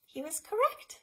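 lilting read-aloud to little ones; story time reading. Acted. Librarian reading the picture book to kids, lilting.

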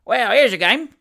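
high, loud, relishing exclamation; gleeful cry. A narrator's reading. Sam has Job locked in the kitchen, gleeful cry. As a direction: crow over the fun. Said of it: "cried Sam".

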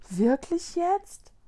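high, slow, tentative question; hesitant. A narrator's reading. Ghost boy asks if he truly belongs, hesitant. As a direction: ask slowly, hardly believing it. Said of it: "fragte er zögerlich".

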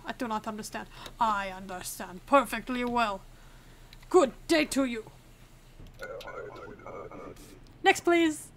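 hammed-up mock Arstotzkan accent; deliberately bad Arstotzkan accent; awful Arstotzkan accent. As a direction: play a border inspector with a put-on Arstotzkan accent. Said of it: "I cannot stop doing this awful Arstotzkan accent".

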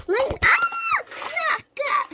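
high, shrill frightened screams; screaming in fear. Pretend. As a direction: scream as the ghost grabs you. Screams as the ghost attacks.

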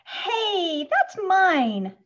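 high, loud protest from the floor; upset child's shout. A narrator's reading. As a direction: call it out, indignant. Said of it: "shouts Schubert from the floor".

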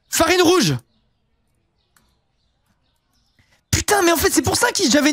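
high, excited burst of surprise; sudden realization. Real, not acted. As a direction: it just hit you what you forgot. Realizes forgotten red flour.

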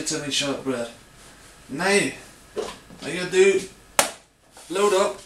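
easygoing put-on Scottish; imperfect Scottish accent. Baker explaining how to add cherries and flour, put-on Scottish accent. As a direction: carry on in a homemade Scottish accent. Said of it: "strange accent through the whole of my video".

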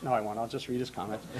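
sheepish aside, no accent attempted; quiet backing-off aside. Real, not acted. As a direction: drop the voice and retreat from the joke. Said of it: "imitation of him".

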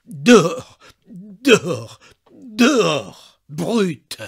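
loud, rising, broken cries of dehors; desperate father driving out the maid, yelling; stammering furious father. A narrator's reading. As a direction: throw her out in a stammering rage. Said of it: "Il balbutiait".

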